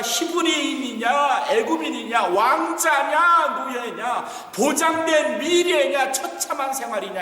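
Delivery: rousing preacher calling out each choice; impassioned preaching. Real, not acted. Preaching at full force.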